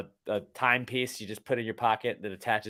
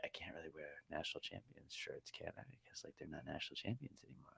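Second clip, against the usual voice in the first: muttered under breath, much softer; half to himself, much softer; quiet self-talk; 6 semitones lower, 24 dB quieter, faster than usual. Retold or imitated. Guy talking himself out of a shirt, quiet aside.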